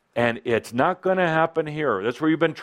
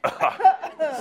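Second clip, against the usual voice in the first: laughing. Real, not acted. Laughter after the group scream.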